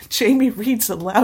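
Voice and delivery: normal talking voice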